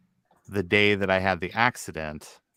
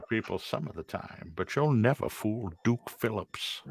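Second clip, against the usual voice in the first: softer pitchman voice in a Duke Phillips impression; 6 dB quieter than usual. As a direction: deliver it like a folksy TV pitchman. Quoting Duke Phillips ad line.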